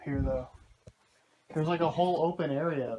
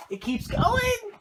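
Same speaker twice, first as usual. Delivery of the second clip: high, loud, amazed cry; excited shout; 8 dB louder, slower than usual. Real, not acted. Discovering the cave continues.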